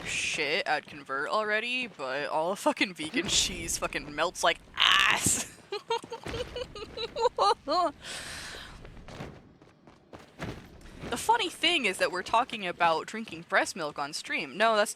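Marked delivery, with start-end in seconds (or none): 5.7-9.3 long high giggling fit; drawn-out giggle; high long laugh. Real, not acted. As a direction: dissolve into giggles.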